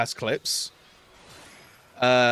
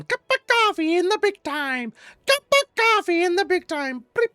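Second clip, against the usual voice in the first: very high squawking parrot imitation. Pet bird squawking the catchphrase around the flat, high parrot voice. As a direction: screech it like a pet bird mimicking its owners. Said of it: "feather friend hopping around the flat going".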